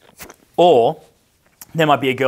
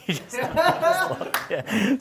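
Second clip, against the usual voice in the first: words breaking into high laughter; laughing; 12 semitones higher, 5 dB quieter, much slower than usual. Real, not acted. Recalling the kiss prank, he laughs through his words.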